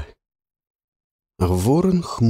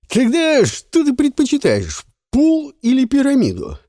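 mocking taunt; 5 dB louder than usual. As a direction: sneer at her skill. Жуан проверяет её. (издевательский тон)

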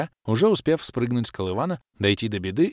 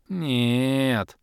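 drawn out; 4 semitones higher than usual. A narrator's reading. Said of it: "протянул Орбит".

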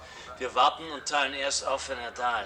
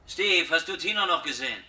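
calling Steve again, louder and more insistent; 5 semitones higher, 7 dB louder than usual. Acted. Man calls again for Steve, louder this time. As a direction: raise the voice to reach Steve across the room.

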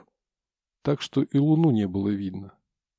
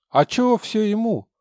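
whiny protest; 10 semitones higher, 6 dB louder than usual. Acted. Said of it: "попытался возразить Витя".

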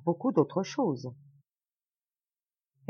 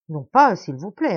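much louder, quick protest; urgent interruption; 8 dB louder than usual. A narrator's reading. The brother interrupts the host, protesting loudly. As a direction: cut in to refuse more food. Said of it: "interrompit mon frère".

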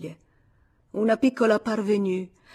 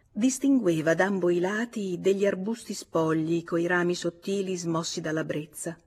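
ordinary delivery, nothing marked

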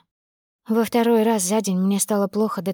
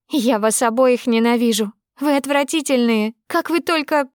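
high, raised cry of disgust breaking off; disgusted outburst; 4 semitones higher than usual. Acted. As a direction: choke on the disgust mid-sentence. Daughter screams at father, friend.

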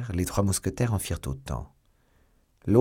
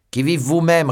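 loud, higher retort thrown back; shouted challenge; 8 semitones higher, 7 dB louder than usual. A narrator's reading. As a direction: bark the question right back.

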